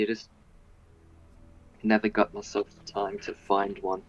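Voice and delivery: normal talking voice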